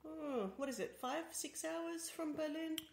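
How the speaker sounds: usual speaking voice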